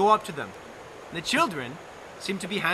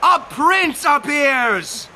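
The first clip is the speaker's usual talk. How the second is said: dramatic slow reveal; 7 semitones higher, 9 dB louder, much slower than usual. A narrator's reading. Dramatic entrance.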